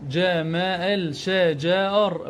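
very slow "ج، م، ال، ش، ج، ار"; sounding out. Shown as a demonstration. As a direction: stretch each piece of "ج، م، ال، ش، ج، ار" separately. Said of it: "ألفظه".

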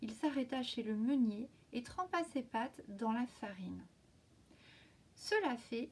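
plain reading voice

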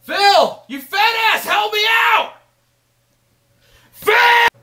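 very high, loud shout for help; desperate yelling. Acted. Dan yelling for Phil to help him out, desperate shout. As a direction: yell insults to get help.

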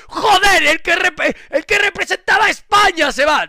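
high, loud outraged yell; angry shout. Real, not acted. Streamer ranting at online critics, outraged yelling. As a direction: blast the critics.

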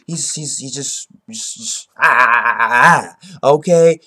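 loud frustrated stammering; exasperated stammer. Real, not acted. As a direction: sputter it out, lost for words.

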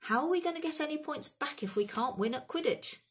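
high-pitched, exasperated outburst; thundering outrage. A narrator's reading. Team captain refusing a resignation, thundering high. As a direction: rail at the useless idea, pitched high. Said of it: "Wood thundered".

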